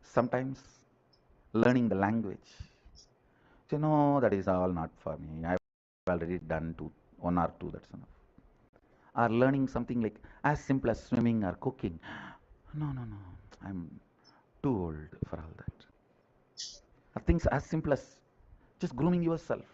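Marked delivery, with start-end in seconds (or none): 3.7-8.1 low, softer mock resignation; resigned excuse. Voices someone giving up on learning. 12.7-15.8 soft, sinking voice of someone giving up; mock weary refusal. Voices someone declining to learn.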